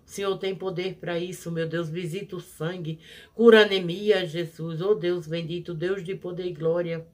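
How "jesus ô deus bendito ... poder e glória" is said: normal talking voice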